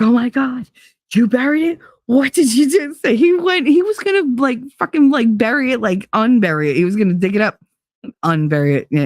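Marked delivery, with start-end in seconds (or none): 0.0-2.9 high-pitched horrified questioning; hammed-up aghast disbelief; mock horror. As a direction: imitate an ex panicking at the discovery. Acting ex horrified at burial.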